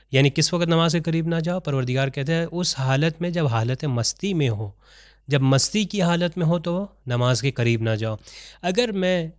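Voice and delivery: normal talking voice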